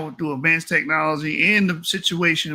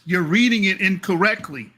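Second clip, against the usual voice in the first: ordinary delivery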